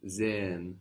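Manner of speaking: "Sehen." said with a voiced Z, zay-en; German S as Z. Shown as a demonstration. Teacher repeats "Sehen." for the student with a Z sound. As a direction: start "Sehen." on a buzzing Z. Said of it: "sounds like a Z".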